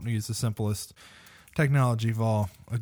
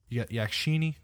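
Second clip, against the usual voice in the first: usual speaking voice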